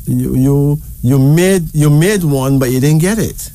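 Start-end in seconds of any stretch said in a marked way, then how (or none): none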